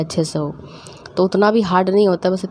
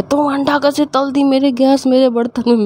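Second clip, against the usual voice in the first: mom yelling; 7 semitones higher, 4 dB louder than usual. Retold or imitated. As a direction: imitate mom shrieking about her stove. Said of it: "mummy चिल्ला चिल्ला के". (ऊँची, धीमी, ज़ोरदार डाँटती mummy की नकल)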